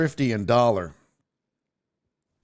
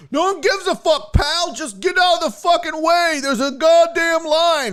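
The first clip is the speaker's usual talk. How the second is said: high mock yell of a rude man; angry Ken voice; 6 dB louder than usual. Imitating an angry man in the rental line, mock yell. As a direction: ham up an angry line-jumper. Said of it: "And he's like".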